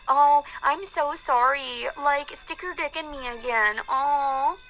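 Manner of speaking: high, gushing fake apology; mock apology. Woman sneering at rapists with a fake apology, mockingly sweet.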